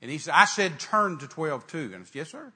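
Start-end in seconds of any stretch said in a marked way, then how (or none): 0.3-1.9 loud, slow, insistent order; imitating an insistent God who will take no argument; stern repeated command. God repeats order when answered back. 2.2-2.5 small, high, softer reply; imitating a chastened, obedient yes; meek compliance. Meek reply to God's rebuke.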